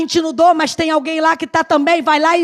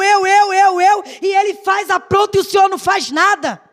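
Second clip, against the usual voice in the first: higher, petulant protest; whiny complaining; 4 semitones higher than usual. Retold or imitated. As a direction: whine like a fed-up child. Her own complaint acted out. (reclamação manhosa e aguda)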